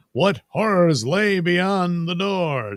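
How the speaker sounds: slow, high, mock dramatic trailer line; mock horror narrator. Vlogger teasing the jail door, slow mock-dramatic voice. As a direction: draw it out like a spooky movie trailer.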